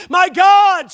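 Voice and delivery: high, loud anguished cry from the cross; preacher's full-voiced quoted cry. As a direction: cry it out, drawing out GOD. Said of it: "he cried out with a loud voice".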